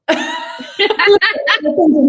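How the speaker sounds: loud high laugh; overwhelmed laugh. Real, not acted. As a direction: burst out laughing, touched. Overwhelmed by praise.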